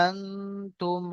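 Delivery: "আনতুম" slow and soft, n held in the nose; ghunnah. Shown as a demonstration. Teacher demonstrating the rule just explained, softly hummed n in "আনতুম". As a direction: stretch the nasal n of "আনতুম" for a beat. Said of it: "একালি পরিমাণ গুণনা করে পড়তে হবে".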